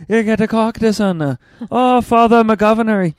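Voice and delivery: loud, high, hammed-up Boston accent; overdone Boston accent. Boston accent slipping into someone else, overdone and loud. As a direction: belt out a cartoonish Boston accent. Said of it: "I don't know why I become a".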